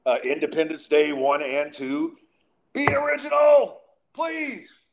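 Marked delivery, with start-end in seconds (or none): 2.7-4.8 high, loud pleading cry of frustration; exasperated shout. Real, not acted. Ranting at lazy sci-fi writers, a high exasperated shout. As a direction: yell it up at the sky, fed up. Ranting about copied alien plots.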